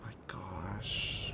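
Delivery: breathy whispered sigh of disbelief; exasperated whisper. Real, not acted. Gamer whispering to himself after his bag vanished. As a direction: whisper it, fed up.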